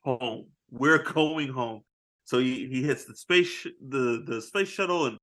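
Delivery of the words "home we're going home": slow resolute. Retold or imitated. Acting the cosmonaut.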